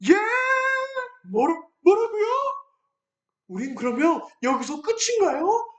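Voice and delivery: dismayed Israelites hearing they can't enter; shocked disbelief. Acted. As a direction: gasp out the question in disbelief. People hear the bad news.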